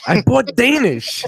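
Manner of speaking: high, slow sheepish insistence; mock defensive. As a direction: stretch it out like a guilty excuse.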